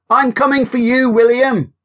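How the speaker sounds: high, bright call across the field; calling out playfully. Acted. Teasing call across a field. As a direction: Hannah calls out to tease William. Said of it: "Hannah called".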